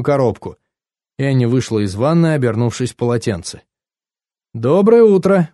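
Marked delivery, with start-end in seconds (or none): 4.5-5.5 higher and louder, brisk morning cheer; cheerful, perky greeting. A narrator's reading. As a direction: chirp it a little too loudly. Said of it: "бодро сказала она".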